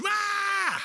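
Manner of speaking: high panicked shout, dragged out at the end; frantic high yell stretching the last words; panicked cry. Retold or imitated. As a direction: scream for help as the boat sinks. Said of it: "Or you just".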